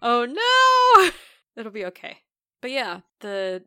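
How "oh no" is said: high loud alarmed cry; shocked gasp. Real, not acted. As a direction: yelp it in sudden dismay. Reaction to forgotten episode.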